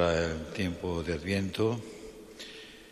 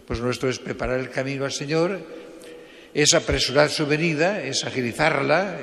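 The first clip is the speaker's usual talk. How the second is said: fervent preaching; 5 semitones higher, 6 dB louder than usual. Real, not acted. Preacher rising to fervent exhortation.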